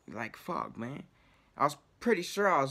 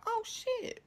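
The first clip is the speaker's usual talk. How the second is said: high, sudden "oh, shit"; mock excited discovery; surprised oh shit; 5 dB quieter than usual. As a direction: react like you spotted treasure. Spots cardboard, sudden reaction.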